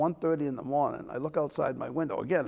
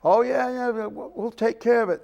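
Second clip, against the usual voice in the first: mother crying; 7 semitones higher, 6 dB louder than usual. Retold or imitated. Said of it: "the mother starts crying".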